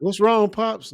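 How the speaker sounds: put-on high, loud concerned kid voice; child asking a teary father what's wrong; kid asking dad. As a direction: play the child checking on dad. Acting out a family scene.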